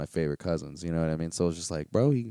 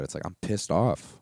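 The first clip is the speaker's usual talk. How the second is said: ordinary talk, nothing marked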